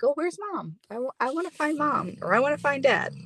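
high whiny voice of a lost pup; puppy voice. Retold or imitated. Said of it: "they're like".

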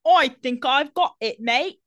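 high, loud Harry Potter-style British; hammed-up fake British; bad British accent. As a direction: parody a film-taught British accent. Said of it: "He watched all eight Harry Potter movies and said".

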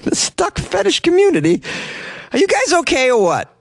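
high disbelieving question; incredulous. Real, not acted. Incredulous repeat of quote.

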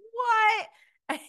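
high, loud shocked what. Retold or imitated. Cast members hearing a shock reveal, imitated shocked squeal.

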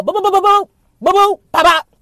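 rapid-fire high, loud boop-boop-pop; imitated punch noises. Storyteller acting out a friend's beatdown, imitated punch noises. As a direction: sound out a flurry of blows.

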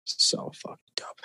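hushed awe; whispering. Real, not acted. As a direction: breathe it out in wonder.

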